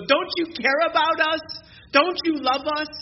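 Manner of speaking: high and loud, a whiny cry for help; desperate plea. Retold or imitated. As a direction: beg God like someone panicking in trouble. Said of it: "We get into trouble and we go".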